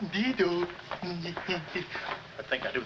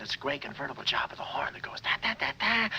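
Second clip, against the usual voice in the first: whispering; 5 semitones lower, 6 dB quieter than usual. Acted. Hushed escape plan.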